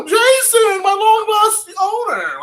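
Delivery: mock overjoyed pet greeting its owner; gushing dog voice. Cat owner voicing an imagined dog, high adoring squeal. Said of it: "like a dog would do".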